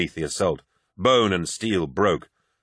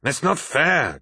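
higher, frustrated protest; growled; 7 semitones higher, slower than usual. A narrator's reading. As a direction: growl out the complaint in rising frustration. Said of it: "growled Abaddon".

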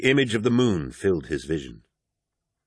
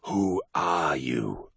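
low, very slow, otherworldly wolf; a ghostly wolf questioning the lost hero; eerie wolf voice; 4 semitones lower, 5 dB quieter, much slower than usual. Acted. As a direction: draw each word out like a spectral beast.

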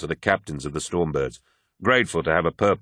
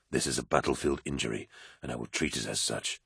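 softer, lower inner voice; quiet, lower self-talk under the chaos; inner thought; 6 semitones lower, 10 dB quieter than usual. A narrator's reading. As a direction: think it to yourself, steadying. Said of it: "he thought".

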